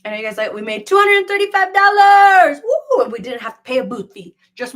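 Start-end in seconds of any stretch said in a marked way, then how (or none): 0.9-3.0 much higher and louder, building to a whoop; real delighted celebration; excited. Real, not acted. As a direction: cheer the number like a win. Celebrating live earnings.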